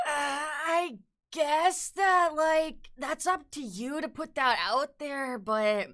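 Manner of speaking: high, slow, whiny mock of a hesitant boss. Mocking the boss who dodges the question, high and slow.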